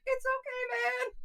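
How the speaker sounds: high, soft crying voice; mock weepy comfort; teary reassurance. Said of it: "Rectful was just like".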